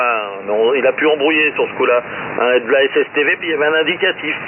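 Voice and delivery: normal talking voice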